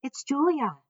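high, ringing excited call; girl's yell. A narrator's reading. Girl announcing herself to gorilla, yelling high. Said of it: "the girl yells".

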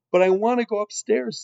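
put-on high, loud girlish plea; protesting serving girl. A narrator's reading. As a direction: plead loudly as a thwarted girl. Mig protests being kept below.

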